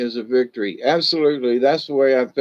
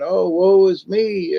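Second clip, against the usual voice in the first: slow, higher, hammed-up self-pity; mock woe; 6 semitones higher, 4 dB louder, slower than usual. Picturing a bad day, mock lamenting aloud, slow and theatrical. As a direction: ham up a mournful sigh of self-pity.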